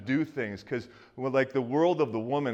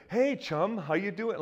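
high, bright mock Canadian hello; Canadian greeting; 8 semitones higher than usual. Pastor mimicking a friendly Canadian stranger, high mock accent. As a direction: ham up an overly friendly Canadian. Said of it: "they're like".

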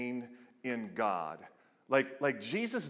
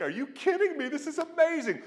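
much higher, squealing with delight; giddy amazed squeal; ecstatic; 4 dB louder than usual. Acted. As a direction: shriek it in sheer amazement. Godhead rejoicing at creation.

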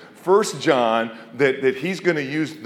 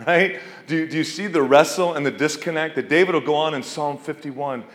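usual speaking voice

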